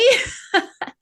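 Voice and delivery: high embarrassed laugh. Real, not acted. Embarrassed about surprise video.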